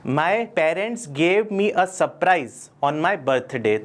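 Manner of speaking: drawn-out sample sentence for learners; slow example sentence. Shown as a demonstration. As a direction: say it slowly, word by word. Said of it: "example sentence".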